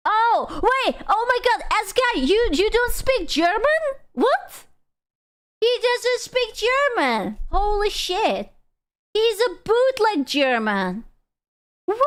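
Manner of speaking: high, hammed-up gasping outrage; squealing fake disbelief, stretched out slowly at the end; mock shock. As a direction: act scandalized by a huge discovery.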